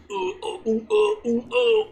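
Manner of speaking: hammed-up rising ooh noises before throwing up; mock retching groans. Friends playing a game, mimicking the character's retching groans. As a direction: groan like you're about to puke. Narrating character about to barf.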